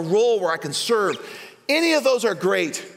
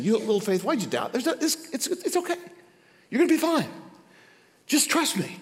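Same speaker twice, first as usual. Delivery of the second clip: soft, higher, kind voice; gentle reassurance; 5 semitones higher, 3 dB quieter than usual. Retold or imitated. Jesus reassuring sinking Peter, soft and tender. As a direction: comfort a frightened friend.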